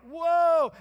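loud, stretched astonished whoa; amazed whoa. Retold or imitated. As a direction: gasp it out, wide-eyed. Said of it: "and I'm like".

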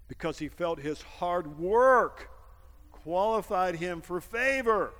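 slow, loud preaching emphasis; slow, emphatic build. Real, not acted. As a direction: press hard on every word.

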